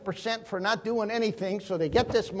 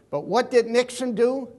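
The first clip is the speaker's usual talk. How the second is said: usual speaking voice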